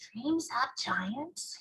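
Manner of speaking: soft, slow muttering under the breath; muttered to himself. Acted. Giant reads jar labels, slow soft mutter. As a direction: mutter it to yourself, drawn out. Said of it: "he muttered to himself".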